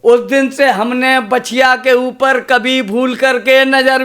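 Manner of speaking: measured, rhythmic recitation of a comic verse; poem recitation. Acted. Poet reciting a funny poem to school students, rhythmic recitation. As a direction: recite each line to the audience with a storyteller's lilt.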